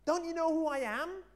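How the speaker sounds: high, loud indignant donkey shout. Acted. Donkey shouts at the villagers by the well, high and loud. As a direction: shout up at the crowd, demanding to be noticed. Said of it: "he shouted out to them".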